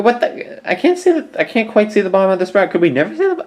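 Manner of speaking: very high, squeaky voice; high-pitched comic fussing; squeaky bafflement. Pretend. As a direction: squeal in puzzled confusion. Baffled by what is on screen.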